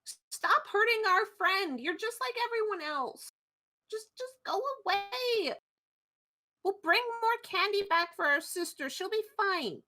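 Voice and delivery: high-pitched, protesting cry; upset shout. Acted. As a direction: shout high to defend a hurt friend. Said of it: "and shout".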